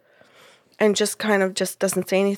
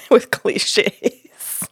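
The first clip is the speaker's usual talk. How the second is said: laughing; 3 dB louder than usual. Real, not acted. Storyteller relaying Jody's jab at her, laughing. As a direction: laugh through the punchline. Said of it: "I had such a good laugh".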